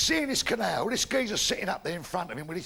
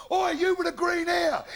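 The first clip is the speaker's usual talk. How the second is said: loud high shout across the street; shouted call; 8 semitones higher, 4 dB louder than usual. Acted. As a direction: yell it at him from behind.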